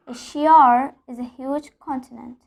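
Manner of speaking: "Asia" ending in an added R before "is"; intrusive R. Shown as a demonstration. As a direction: add an R linking "Asia" and "is". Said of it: "So I'm adding an R".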